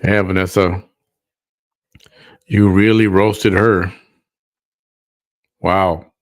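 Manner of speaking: loud mock praise; loud sarcastic cheer. Real, not acted.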